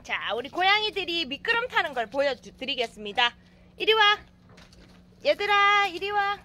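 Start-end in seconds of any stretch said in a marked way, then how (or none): 3.8-6.4 calling the cats. Real, not acted.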